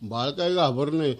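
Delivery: ordinary delivery, nothing marked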